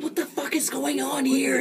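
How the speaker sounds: high confused yelp. Acted. Confused character shouting.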